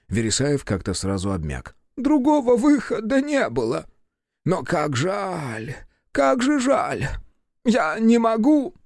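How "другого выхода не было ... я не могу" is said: high, drawn-out plaintive voice; slow down into a helpless high wail; grieving lament. A narrator's reading. Professor giving in over lost specimens, grieving. Профессор обмяк и горюет.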